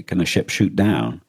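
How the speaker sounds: usual speaking voice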